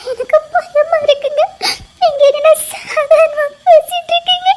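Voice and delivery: high, tearful and shaking with sobs; scared sobbing. Acted. Wife sobbing to her calm husband, scared. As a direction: plead through fear.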